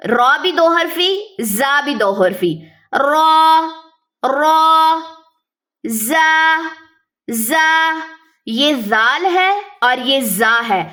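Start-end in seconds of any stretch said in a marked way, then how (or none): 2.9-8.0 را then زا with a whistling ز; letter names recited. Shown as a demonstration. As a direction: give زا its hiss, را none. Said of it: "زا میں سیٹی ہوتی ہے".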